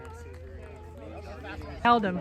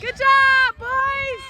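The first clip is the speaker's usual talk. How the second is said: high shouted cheer; yelling from the sidelines; 13 semitones higher, 7 dB louder, much slower than usual. Real, not acted. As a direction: shout praise across the field.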